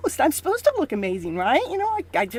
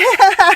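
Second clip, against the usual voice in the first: high, loud laugh; a bright burst of laughter; quick laugh; 7 semitones higher, 11 dB louder than usual. Real, not acted. Host laughing after "love story", loud.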